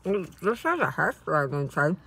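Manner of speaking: chewing while talking; eating a breakfast wrap; mouth full. Real, not acted. Seen 3: Right speaker bites wrap, then chews and talks mouth full.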